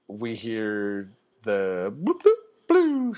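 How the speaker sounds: siren bloop. Retold or imitated. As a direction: mimic the police siren whoop. Imitating police siren.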